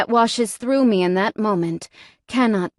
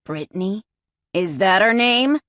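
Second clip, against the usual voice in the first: ordinary delivery, nothing marked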